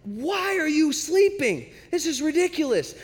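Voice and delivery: high-pitched, incredulous outburst; hammed-up frantic protest, stretching the question; mock screaming. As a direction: act out panicked disbelief, voice way up. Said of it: "They're screaming".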